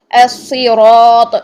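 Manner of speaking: الصراط very slow, thick held s; heavy saad recitation. Shown as a demonstration. Teacher showing saad in recitation, الصراط drawn out and heavy. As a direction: stretch الصراط with full-mouthed heavy sounds. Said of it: "صاد کو بھی آپ دیکھ لیجئے".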